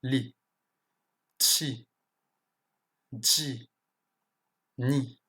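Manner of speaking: "li, chi, ji, ni" with a clear French i; isolated syllables "li, chi, ji, ni", pure i vowel; normal I. Shown as a demonstration. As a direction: say each of "li, chi, ji, ni" separately with ee. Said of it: "le I normalement".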